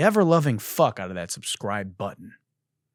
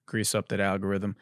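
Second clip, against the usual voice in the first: normal talking voice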